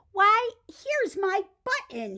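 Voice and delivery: high, loud, slow delighted cry; excited cry. Acted. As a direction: shout with joy at finding it. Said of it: "he cried".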